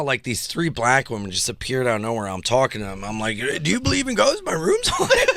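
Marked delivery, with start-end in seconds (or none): 3.4-5.3 much higher, laughing excited question; squealing ghost news; giddy squeal. Retold or imitated. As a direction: gush it out giddily. Excited telling to strangers.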